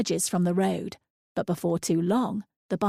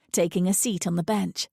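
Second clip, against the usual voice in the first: usual speaking voice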